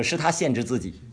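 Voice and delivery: normal talking voice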